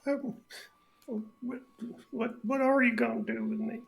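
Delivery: timid worried ask; nervous captive. Acted. Trapped brigand asking his captors his fate, high and anxious.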